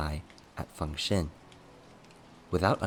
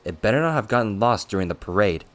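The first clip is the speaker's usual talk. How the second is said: louder, pitched-up reproach; exasperated scolding; 3 semitones higher, 7 dB louder than usual. Acted. Attendant scolding his scatterbrained master over a lost pearl, exasperated. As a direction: scold in a loud, pressed rush.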